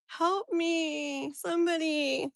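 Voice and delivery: high, drawn-out plea; mock despair. Streamer calling for anyone to help, mock despair. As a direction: plead helplessly, hammed-up.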